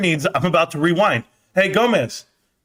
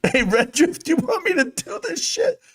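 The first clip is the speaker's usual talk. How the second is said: mock tough guy; 11 semitones higher than usual. Mock challenge to chat.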